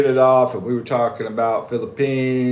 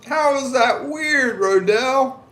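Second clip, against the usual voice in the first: high, slow, incredulous; amused disbelief; 14 semitones higher, 3 dB louder, slower than usual. Real, not acted. Asking a viewer about ketchup, amused and incredulous. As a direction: stretch it out in disbelief. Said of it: "amused disbelief at viewer".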